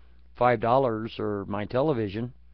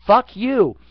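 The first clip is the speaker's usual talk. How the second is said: a higher, louder outburst; loud fuck you; 8 semitones higher, 8 dB louder than usual. Real, not acted. Ranting at politicians, shouts fuck you. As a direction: spit it out, louder.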